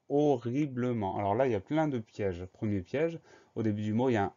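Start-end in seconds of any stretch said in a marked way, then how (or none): 0.1-1.2 HORRIBLEMENT slow and split, ho-rri-ble-ment; syllable by syllable. Shown as a demonstration. Teacher introducing a long spelling word, syllable by syllable. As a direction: sound out each syllable of HORRIBLEMENT separately.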